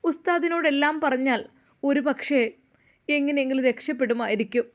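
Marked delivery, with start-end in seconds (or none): none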